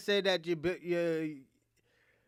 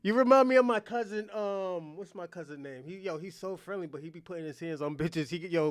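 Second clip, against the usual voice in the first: normal talking voice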